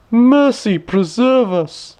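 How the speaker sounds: high, slow, startled cry of dismay; shocked old minister. A narrator's reading. Old minister to himself at the empty loom, shocked and high. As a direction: gasp it out as a horrified old courtier. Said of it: "as he opened his eyes wide".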